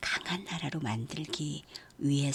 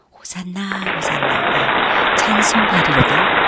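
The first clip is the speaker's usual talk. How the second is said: raised, louder cry of praise; crowd's shout; 3 semitones higher, 12 dB louder, slower than usual. A narrator's reading. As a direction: call out Hosanna like a welcoming crowd. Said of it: "높이 흔들면서 소리쳐요". (환호하는 군중의 외침)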